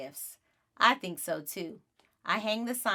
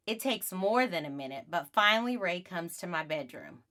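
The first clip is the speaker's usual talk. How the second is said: usual speaking voice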